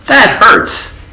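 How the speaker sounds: squeaky, hurt voice; a well complaining of pain; high complaining well. A narrator's reading. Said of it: "said the well".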